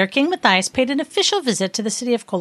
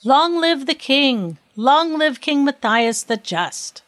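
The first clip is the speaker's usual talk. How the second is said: slow, higher, drawn-out acclaim; cheering; 7 semitones higher, slower than usual. A narrator's reading. People cheering the king on his way, drawn-out cheer. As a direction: cheer it out, stretching each word. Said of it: "shouting and cheering him on his way".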